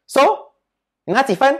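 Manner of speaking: high, sharp demand for the score; stern tiger mom. Retold or imitated. Mother at the car demanding the score, high and sharp. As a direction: bark the question like an angry mother. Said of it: "Then she will be like".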